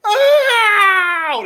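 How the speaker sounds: long, high, loud yowl; mock cry of pain. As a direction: howl like someone punched in the eye. Imitates punched man's cry.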